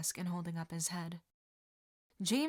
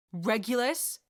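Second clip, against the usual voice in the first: demanding; 3 semitones higher, 7 dB louder than usual. Acted. Mother demanding, rise.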